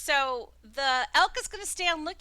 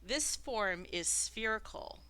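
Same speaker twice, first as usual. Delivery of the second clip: measured, slightly lowered scene-setting; game master narration; 5 semitones lower, 4 dB quieter than usual. A narrator's reading. Game master describing a webbed room to Pants, measured narration. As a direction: describe the room deliberately, phrase by phrase.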